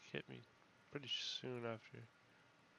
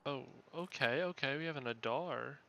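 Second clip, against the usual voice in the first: loud excited okays; thrilled by a rare find; 2 semitones higher, 7 dB louder than usual. Real, not acted. Excited at finding loot.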